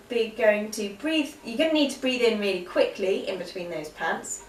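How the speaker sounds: normal talking voice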